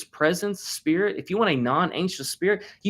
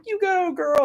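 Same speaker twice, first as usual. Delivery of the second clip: cheering; slower than usual. Retold or imitated. Dad cheering daughter on.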